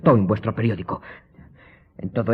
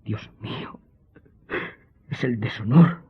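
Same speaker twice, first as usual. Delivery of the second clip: a son's shame spilling out in tears; breaking into tears; 2 semitones higher than usual. Acted. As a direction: whisper the first words, then cry out the disgrace. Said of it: "se echó a llorar".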